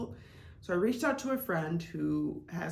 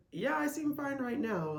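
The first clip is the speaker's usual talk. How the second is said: raised, dry sarcastic yes; wry agreement; 7 semitones higher than usual. Retold or imitated. Answering the doubter with dry sarcastic agreement. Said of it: "it's like".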